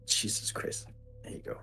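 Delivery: whispered under breath. Real, not acted. Startled muttering under breath.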